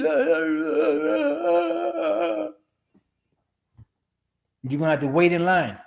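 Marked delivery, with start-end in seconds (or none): 0.0-2.5 high, fake sobbing sounds; mock sobbing. Mocking women crying, high fake sobs. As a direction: blubber high like a crying woman. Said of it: "crying and shit".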